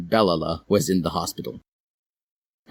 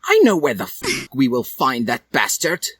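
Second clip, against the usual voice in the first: Draco voice; 6 semitones higher than usual. A narrator's reading. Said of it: "said Draco".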